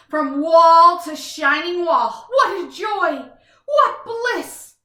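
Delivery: rapturous, drawn-out delight; blissful gushing. Acted. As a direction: swoon over the screens. Gushing over his screens.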